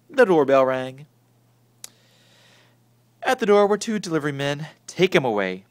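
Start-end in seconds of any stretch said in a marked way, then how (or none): 4.9-5.6 firmly. A narrator's reading. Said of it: "Father said firmly".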